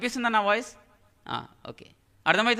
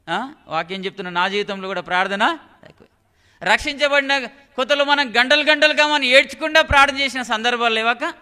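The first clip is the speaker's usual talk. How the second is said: normal talking voice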